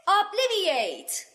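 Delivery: all-out shouted incantation; shouted spell. Acted. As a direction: scream the curse to the rafters. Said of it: "فریاد زد".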